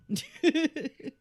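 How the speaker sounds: loud, high giggle; burst of laughter. Real, not acted. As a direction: break into a giggle. Laughs at own remark.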